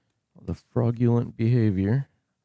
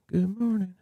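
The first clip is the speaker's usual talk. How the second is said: much higher, lilting good morning; sing-song high greeting; 10 semitones higher, 4 dB quieter than usual. Shown as a demonstration. Streamer greeting his viewers in a high sing-song. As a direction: lift it way up like a cheery host.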